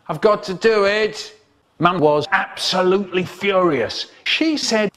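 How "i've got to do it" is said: high petulant whine; sulky. Retold or imitated. Dad sulking at mum, a high petulant whine. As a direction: sulk like a spoilt kid. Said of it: "My dad starts to sulk".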